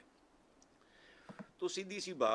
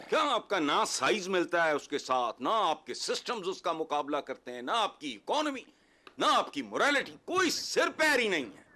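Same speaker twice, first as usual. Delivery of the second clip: indignant rant; 2 semitones higher, 4 dB louder than usual. Real, not acted. Guest berating his country for comparing itself, indignant. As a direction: fire off each failing, climbing to an outburst.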